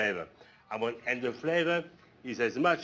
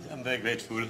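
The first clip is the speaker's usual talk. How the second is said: laughing; 4 dB quieter, faster than usual. Real, not acted. Laughing thanks after praise.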